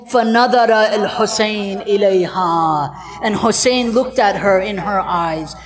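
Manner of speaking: usual speaking voice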